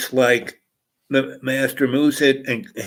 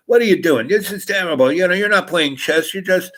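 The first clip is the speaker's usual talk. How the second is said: exasperated complaint; 7 semitones higher than usual. Retold or imitated. Upset table hustler complains.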